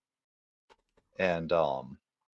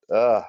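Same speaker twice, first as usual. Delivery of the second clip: loud, higher-pitched ugh; frustrated groan; 5 semitones higher, 14 dB louder than usual. Real, not acted. Player muddled over the turn, loud frustrated groan. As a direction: let out a burst of exasperation.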